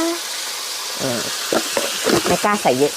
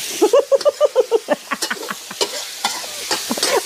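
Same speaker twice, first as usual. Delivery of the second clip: high giggle. Real, not acted. Laughs after the mishap.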